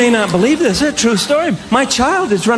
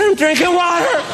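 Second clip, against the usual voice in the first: put-on child calling out; 7 semitones higher than usual. Daughter answers from the bathroom.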